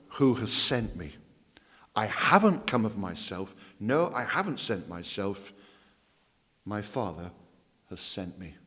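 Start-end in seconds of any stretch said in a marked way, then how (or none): none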